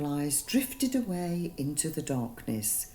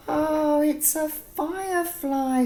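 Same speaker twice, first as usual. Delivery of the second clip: high, loud and delighted; amazement; 11 semitones higher, 6 dB louder than usual. Acted. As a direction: gasp it out in wonder. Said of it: "said Mister Mango with amazement".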